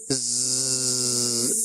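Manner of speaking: low buzzing zz like a plane on the runway; imitating a plane's buzz; buzzing imitation. Shown as a demonstration. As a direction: buzz a low zz through the teeth. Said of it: "اس کی آواز آتی ہے، کیسے آتی ہے؟".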